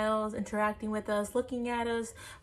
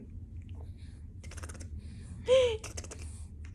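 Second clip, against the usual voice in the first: breathy giggle; 7 dB quieter than usual. Real, not acted. Laughing at baby.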